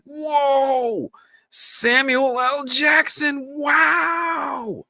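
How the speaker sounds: hammed-up, very high slow shout; mock amazement. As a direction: stretch every word in exaggerated high-pitched awe.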